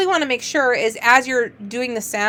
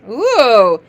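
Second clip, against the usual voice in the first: ordinary talk, nothing marked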